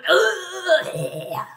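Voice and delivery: high, loud drawn-out groan; mock stabbed groan, hammed up; goofy stabbing sound effect. Shown as a demonstration. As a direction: groan like a villain taking a blade. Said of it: "that I do sound effects".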